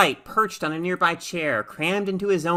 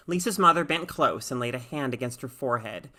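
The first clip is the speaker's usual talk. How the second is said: ordinary delivery, nothing marked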